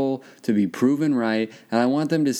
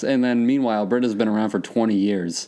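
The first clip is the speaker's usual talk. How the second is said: normal talking voice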